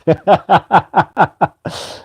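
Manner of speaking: a high burst of laughter; bright, high-pitched laughing burst. Real, not acted. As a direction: laugh out loud at the advice. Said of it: "I'm laughing 'cause it's excellent advice".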